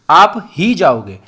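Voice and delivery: ही punched and stretched in a slow "आप ही जाओगे"; slow down and land firmly on ही; stress on ही. Shown as a demonstration. Teacher repeating the example for the class, ही emphasized. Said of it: "ही पर ज़्यादा जोर दिया".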